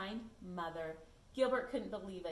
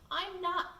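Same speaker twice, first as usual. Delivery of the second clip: high, loud protest cut off; defiant start; 9 semitones higher, 7 dB louder than usual. Acted. Gilbert refusing to be tricked, high loud protest. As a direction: insist you won't be fooled. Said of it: "He said".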